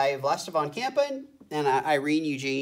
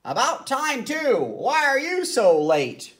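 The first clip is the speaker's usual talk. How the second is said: scolding conductor; 11 semitones higher, 4 dB louder, slower than usual. A narrator's reading.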